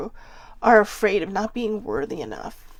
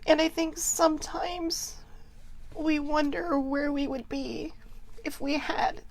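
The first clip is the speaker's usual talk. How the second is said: higher, choked-up tearful voice; 6 semitones higher, 5 dB quieter than usual. Real, not acted. To the viewers, confessing regret, tearful. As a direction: speak through held-back tears. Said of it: "why I'm so emotional today".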